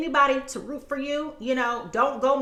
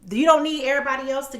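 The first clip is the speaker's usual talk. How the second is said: ordinary talk, nothing marked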